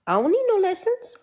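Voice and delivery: put-on high, petulant kid voice repeating it; squeaky childish I don't need no lessons; mock bratty kid. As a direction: play a sulky child refusing.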